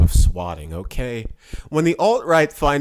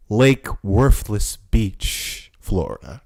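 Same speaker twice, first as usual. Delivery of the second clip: drawn-out slow sarcastic naming; mock local name; 4 semitones lower, much slower than usual. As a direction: say it slowly with scorn. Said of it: "as the locals call it".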